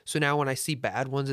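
normal talking voice

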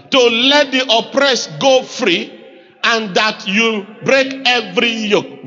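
slow, deliberate reading aloud; congregational scripture reading. Acted. Pastor leading the congregation through a verse, measured reading. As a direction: read out firmly, phrase by phrase.

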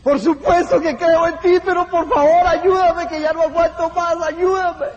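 pleading for help. Acted. Hanging man begs the voice above, desperate and high. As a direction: beg desperately, almost out of strength.

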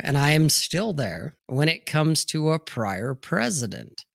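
ordinary delivery

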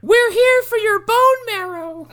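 very high, slow, loud creepy creature voice. Pretend. Dryer creature beckoning the wife, very high and creepy. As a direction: lure her in like a monster in the dryer.

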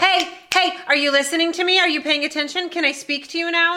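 high-pitched, insistent pestering; mock attention-seeking. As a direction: imitate calling to someone who won't listen. Acts out demanding partner's attention.

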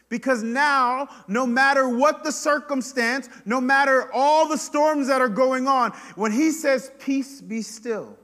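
loud, high, impassioned sermon voice; full-force preaching. Real, not acted. Preacher on wanting to feel God, loud and high.

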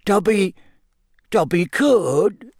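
high, slow, hesitant elf voice; falter, then venture it unsurely; halting, doubtful little elf. A narrator's reading. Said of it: "he said doubtfully".

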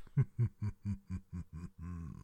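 low sinister chuckle; slow menacing laugh; evil ghostly laugh. Acted. Ghost host laugh after spooky intro.

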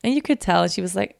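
ordinary delivery

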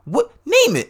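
high, loud incredulous echo; mock-outraged squawk of the suggestion; appalled disbelief. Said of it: "because she's so like".

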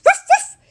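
loud high yapping woof woof; dog barks. Acted. Toby barks at the teddy bear, loud and high. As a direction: bark like an excited little dog. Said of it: "barked Toby".

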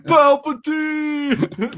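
drawn-out, high, loud call; Rocky shout. Retold or imitated. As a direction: stretch the name out in a yell. Rocky shout, swapped name.

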